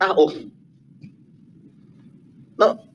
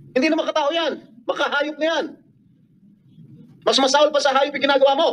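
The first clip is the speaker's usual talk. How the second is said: high-pitched, sustained indignation; real raised voice; 7 semitones higher than usual. Real, not acted. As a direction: berate the accused in a heated, raised pitch. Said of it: "raising my voice".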